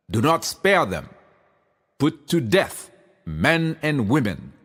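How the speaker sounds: ordinary delivery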